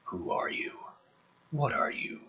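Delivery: soft whispered questioning; hushed, cautious questions to a dying alien. Acted. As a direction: whisper it warily to the wounded creature. McKenna keys translator to predator.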